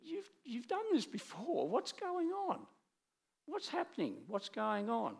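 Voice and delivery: high-pitched mock exasperation; exasperated psalmist. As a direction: demand answers in a high, strained voice. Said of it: "he says".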